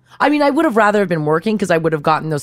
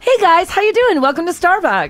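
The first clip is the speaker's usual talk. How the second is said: high, bright customer-service greeting; cheery barista; 11 semitones higher than usual. Acted. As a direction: sing out a welcome to customers.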